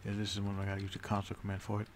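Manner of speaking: ordinary talk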